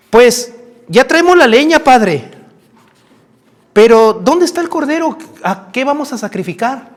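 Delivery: put-on high, questioning child voice; little boy. Boy asks father where the lamb is, high child voice. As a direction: ask it as an innocent young son. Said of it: "el muchachito le dice".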